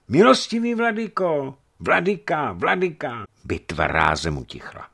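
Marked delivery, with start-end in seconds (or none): 0.1-3.3 high, loud hailing cry; crowd calling out. A narrator's reading. Crowd hails the bishop on the bridge, calling out loud. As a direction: call out to the bishop over the crowd.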